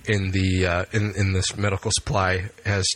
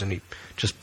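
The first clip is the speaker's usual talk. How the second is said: usual speaking voice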